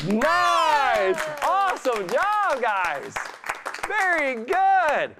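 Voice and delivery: high, slow, delighted praise; excited praise. Real, not acted. Praising kids after trick.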